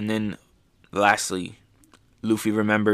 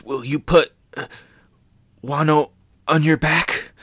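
higher, earnest plea; pleading samurai; 5 semitones higher than usual. Retold or imitated. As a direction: beg him to carry the country. Kinemon pleading with Luffy.

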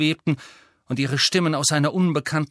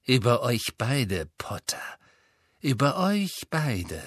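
Malfoy voice; slower than usual. Acted. Malfoy's slow revelation.

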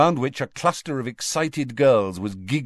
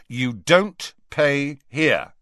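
slow, firm, higher-pitched refusal; 5 semitones higher, 3 dB louder, much slower than usual. A narrator's reading. Fred refuses Harry's money in the shop, firm. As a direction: space the words out firmly. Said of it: "said Fred firmly".

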